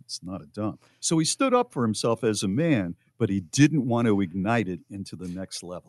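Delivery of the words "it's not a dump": soft, low, restrained reply; lowered volume. Acted. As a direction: say it quietly, without igniting a fight. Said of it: "but he lowered the volume and said".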